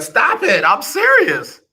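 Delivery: high, sharp mock reprimand; mock scolding. Real, not acted. Scolding caller by name.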